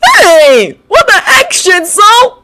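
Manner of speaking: high, loud call across the room. Acted. Kaminari calling to Shinso from across the room, high and loud. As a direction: shout over to him, startled. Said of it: "called out the electrically charged Kaminari".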